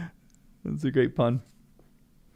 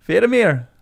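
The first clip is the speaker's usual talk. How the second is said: Scottish "Faramir" with tapped r, FED-a-meer, louder; Scottish accent; 6 semitones higher, 8 dB louder than usual. Retold or imitated. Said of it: "Pippin is Scottish".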